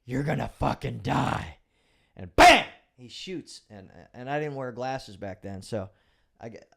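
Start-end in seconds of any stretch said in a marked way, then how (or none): 0.0-1.6 retold threat. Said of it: "he screams at me". 2.2-2.7 shouted gunshot bam, much higher and louder; sudden gunshot exclamation. Retold or imitated. Gunshot sound in story.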